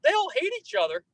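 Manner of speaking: excited friend retelling. Retold or imitated. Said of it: "last night I was on a volunteer conference call".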